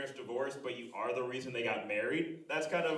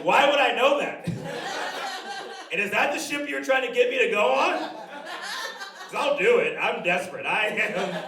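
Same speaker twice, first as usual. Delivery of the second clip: much higher, louder, laughing disbelief; incredulous; 11 semitones higher, 11 dB louder than usual. Retold or imitated. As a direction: yelp it out, cracking up. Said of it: "And I was like".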